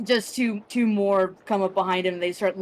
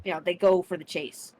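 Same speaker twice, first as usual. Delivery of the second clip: ordinary delivery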